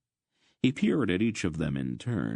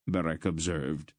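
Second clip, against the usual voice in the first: plain reading voice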